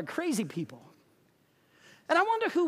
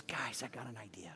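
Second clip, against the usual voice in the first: hushed; 13 semitones lower, 17 dB quieter than usual. Retold or imitated. Telling a secret idea quietly.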